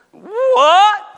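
shouted high indignant what; Paul's angry outburst. Retold or imitated. Paul confronting Peter, a shouted angry what. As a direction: snap it like a hot-tempered apostle. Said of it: "Paul's got a bit of a temper".

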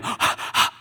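rapid voiceless panting bursts; imitated hyperventilating gasps. Preacher reliving the Lord hyperventilating him, imitated gasps. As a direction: gasp repeatedly as if hyperventilating. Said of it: "the Lord starts hyperventilating me".

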